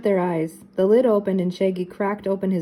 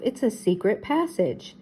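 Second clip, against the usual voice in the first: usual speaking voice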